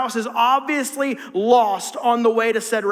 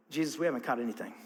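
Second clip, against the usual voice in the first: low, soft and quick, worn out; tired mumble; 10 semitones lower, 11 dB quieter, faster than usual. Retold or imitated. Peter answering Jesus after a fruitless night, low tired mumble. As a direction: mumble it like a beaten fisherman. Peter's weary reply voiced.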